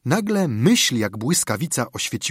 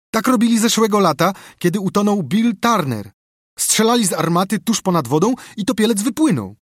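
put-on high, loud, eager boy's storytelling; excited explanation; 7 semitones higher, 5 dB louder than usual. A narrator's reading. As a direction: tell your friends all about it, voice raised high. Boy excitedly explains the cannon custom. (podekscytowane, wysokie objaśnianie)

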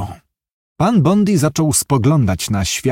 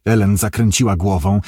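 usual speaking voice

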